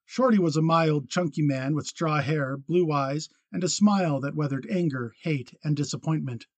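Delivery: usual speaking voice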